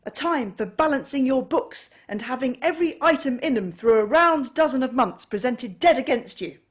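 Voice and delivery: loud, high furious tirade; angry rant. A narrator's reading. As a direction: rail against Christmas with mounting rage. Scrooge rants at nephew.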